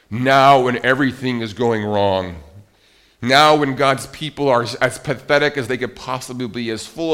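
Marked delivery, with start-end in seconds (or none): 0.1-2.4 "Now" hit hard and louder, then falling; emphatic now. Shown as a demonstration. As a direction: punch the word "Now". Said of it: "That word now is emphatic".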